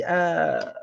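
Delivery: ordinary delivery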